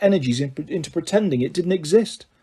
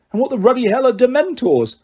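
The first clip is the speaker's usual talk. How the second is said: outraged; 8 semitones higher than usual. Acted. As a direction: bark it in outrage. Vernon outraged shout.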